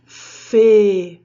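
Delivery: "fê" loud, with a long closed e; drawn-out, close-mouthed "fê"; closed vowel. Shown as a demonstration. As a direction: hold the narrow e in "fê" loudly. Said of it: "o som do E".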